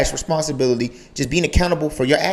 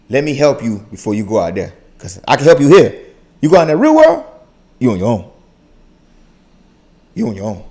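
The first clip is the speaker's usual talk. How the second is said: father's advice voice; 5 dB louder than usual. Retold or imitated. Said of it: "Out of that tone is like".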